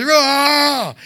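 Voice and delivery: long high roar; imitated kid's roar. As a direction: roar like an excited kid. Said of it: "He was just excited about everything".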